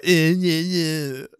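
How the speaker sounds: whiny-sounding nonsense mimicry of a friend; mocking each other. Co-host making fun of his friend, mocking voice. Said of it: "our making fun of each other".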